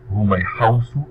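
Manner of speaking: usual speaking voice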